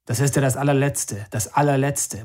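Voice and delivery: usual speaking voice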